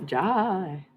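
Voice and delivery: put-on Alabama accent. Joking before returning to the reading, Southern accent. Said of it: "I start picking up their Alabama accent".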